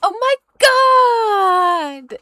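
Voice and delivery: loud, high shriek; over-the-top squealing delight; demonstrated American excitement. Shown as a demonstration. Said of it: "How do we actually say it?".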